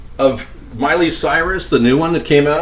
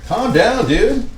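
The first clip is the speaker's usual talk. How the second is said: mock scolding; 10 semitones higher, slower than usual. Real, not acted. Scolding the hot pan.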